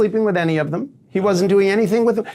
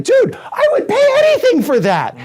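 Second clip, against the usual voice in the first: high, louder outburst of mock amazement; excited client; 4 dB louder than usual. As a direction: shout it like an overjoyed guy, laughing. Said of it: "the guy was like".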